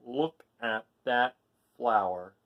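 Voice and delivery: ordinary delivery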